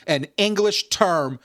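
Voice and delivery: ordinary delivery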